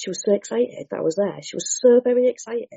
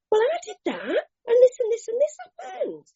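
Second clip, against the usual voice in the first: very high, eager, breathless; eager recognition. Retold or imitated. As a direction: burst out, it's me too.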